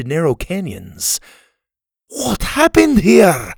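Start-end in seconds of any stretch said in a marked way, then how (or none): 2.1-3.5 high, loud, drawn-out dismay; horrified shout; anguished cry. A narrator's reading. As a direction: cry out in shock at the wreckage. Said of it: "Scotty cried".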